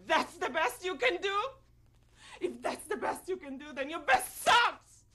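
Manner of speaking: indignant challenge building to a loud finish; angry challenge. Acted. Witness telling off who sold her out, angry and building. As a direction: throw it back at them, hitting the last words hard. Acted monologue, fury at the listener.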